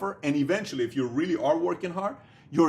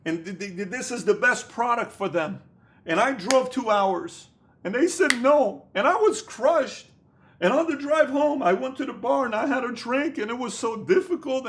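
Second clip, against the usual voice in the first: imitating a sulky rookie entrepreneur telling his sob story, rising to a high loud whine; mocking whiny babypreneur; 6 semitones higher, 3 dB louder than usual.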